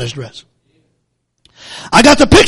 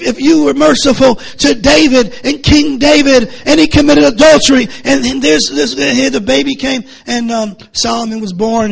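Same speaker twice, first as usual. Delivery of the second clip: usual speaking voice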